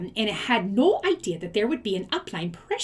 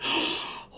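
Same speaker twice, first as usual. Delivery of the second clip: soft gasp; 6 dB quieter than usual. Real, not acted. Gasp at sneaky seller.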